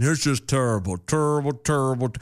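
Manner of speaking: lightly voiced celebrity impression; a hint of a famous commentator; Charles Barkley. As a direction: repeat it like an imitated catchphrase. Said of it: "the only part of him I can imitate".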